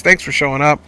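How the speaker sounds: ordinary talk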